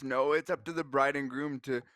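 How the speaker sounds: normal talking voice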